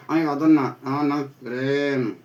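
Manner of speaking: usual speaking voice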